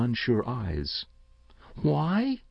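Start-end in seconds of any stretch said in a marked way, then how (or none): 1.8-2.4 put-on high, rising child's "why"; questioning young boy. A narrator's reading. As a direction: ask it as a puzzled boy, pitch climbing. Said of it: "he asked".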